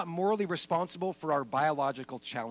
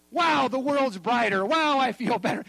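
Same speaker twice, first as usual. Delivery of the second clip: refreshed delight; 8 semitones higher, 8 dB louder than usual. Retold or imitated. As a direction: play the rested man, amazed and loud. Refreshed pastor after his nap.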